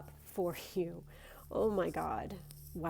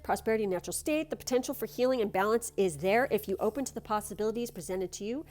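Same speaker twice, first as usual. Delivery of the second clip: card reading; 3 dB louder than usual. Acted. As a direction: read it like a gentle blessing. Reads the card text.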